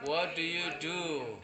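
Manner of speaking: slow, drawn-out classroom English; slow model sentence. Shown as a demonstration. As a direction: stretch out each word for learners.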